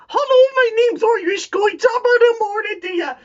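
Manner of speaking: high, hammed-up Irish accent; mock Irish accent. Mocking the Irish rival with a high stage Irish accent. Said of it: "I know you're like".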